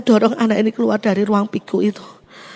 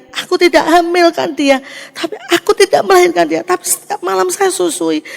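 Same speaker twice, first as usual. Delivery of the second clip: pleading; 7 semitones higher than usual. Retold or imitated. Mother pleads to friend.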